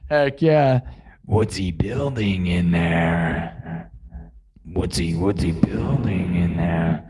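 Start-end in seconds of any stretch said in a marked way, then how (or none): 1.3-7.1 low, slow eerie [name] voice; [name] quote. Retold or imitated. Streamer quotes [name] for TikTok fans, low and slow. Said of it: "You love [name]?".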